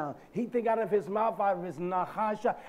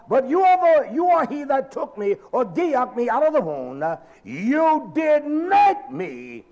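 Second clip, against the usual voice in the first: shouted scripture; 6 semitones higher, 8 dB louder than usual. Retold or imitated. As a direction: preach the psalm at the top of your voice. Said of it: "He says to Yah".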